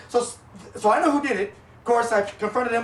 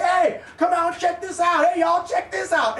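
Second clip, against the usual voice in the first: calling out; 7 semitones higher, slower than usual. Retold or imitated. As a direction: call the gang over to watch. Quoting ranch guys calling out.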